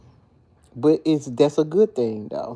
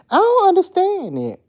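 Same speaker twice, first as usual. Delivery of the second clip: exasperated disbelief; 4 dB louder than usual. Real, not acted. Baffled by her coworkers.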